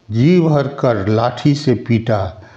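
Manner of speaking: usual speaking voice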